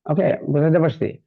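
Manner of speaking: normal talking voice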